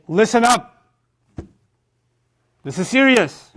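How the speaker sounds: loud, high call to attention; emphatic warning. Acted. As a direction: demand their attention with exclamation marks. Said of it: "ten exclamation marks after it".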